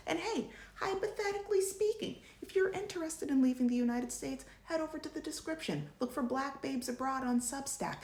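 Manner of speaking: perky high voice for the plug. Real, not acted. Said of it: "talking like this a little bit".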